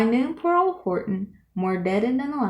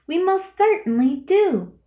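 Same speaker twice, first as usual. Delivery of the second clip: higher, piping little voice; piped; 9 semitones higher, 4 dB louder than usual. A narrator's reading. The tiny mayor piping up to Horton, high and eager. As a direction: pipe it up like a tiny Who. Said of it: "piped the voice".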